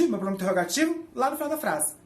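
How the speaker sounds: normal talking voice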